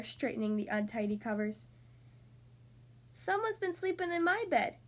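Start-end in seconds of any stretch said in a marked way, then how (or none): 3.1-4.8 high little bear-cub voice; small, high, childish cub; Baby Bear squeak. A narrator's reading. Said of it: "squeaked Baby Bear".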